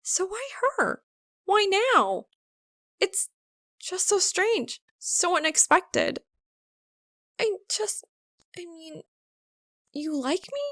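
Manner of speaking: a hint of a flustered girl, thinking aloud; Maka, flustered. A narrator's reading.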